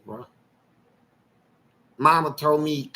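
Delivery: usual speaking voice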